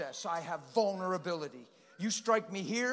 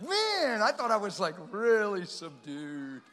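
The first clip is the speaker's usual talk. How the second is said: mock surprise; 5 dB louder, slower than usual. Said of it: "And I'm like".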